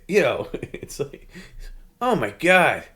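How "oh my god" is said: mock disgust. Imitating Perry's disgust.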